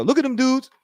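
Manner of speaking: high mocking Church voice; imitating Church. Quoting Church to the chat, mocking. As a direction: raise the pitch and sneer at them dudes. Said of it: "Church would go".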